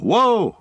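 high, loud yelp of shock; startled shout. A narrator's reading. Frodo gets drenched by a splash, loud high yelp. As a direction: cry out as water splashes. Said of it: "a shout of".

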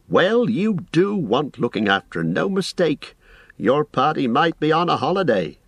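high-pitched, exasperated landlord; scolding innkeeper. Acted. Innkeeper says guests need looking after, mildly scolding. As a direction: chide them like careless guests.

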